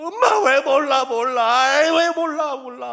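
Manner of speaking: loud, high mock child's wail; hammed-up crying shout, begging to be understood; acted bawling. Little boy protesting to his mother through tears, bawling loudly. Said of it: "그냥 울지 않았겠죠".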